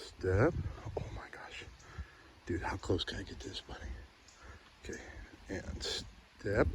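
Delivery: hushed whisper, sneaking closer. Real, not acted. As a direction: whisper so the bunny stays put. Sneaking up on a bunny.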